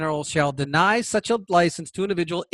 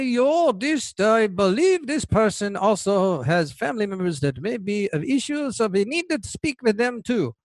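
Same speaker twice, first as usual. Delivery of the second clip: lightly put-on German accent, pitched higher; a hint of a German psychologist, higher; 4 semitones higher than usual. A German-accented psychologist judging a gun buyer, in a light, higher German accent. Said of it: "has a German accent".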